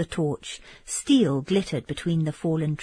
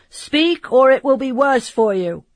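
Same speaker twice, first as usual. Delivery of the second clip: louder, higher warning call; threatening guard shout; 7 semitones higher, 7 dB louder than usual. Acted. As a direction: bark a threat down from the ramparts. Sentinel threatens from the walls.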